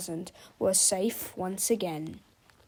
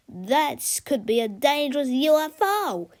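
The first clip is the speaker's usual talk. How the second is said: heroic voice; 4 semitones higher, 5 dB louder than usual. A narrator's reading. Hero's mock-serious voice.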